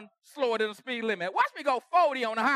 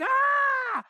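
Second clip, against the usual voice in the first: sudden, much higher, louder "Ah!"; angry shout; 8 dB louder than usual. Retold or imitated. Husband losing control at home, yelling out. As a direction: let out a burst of rage. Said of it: "I'm just like".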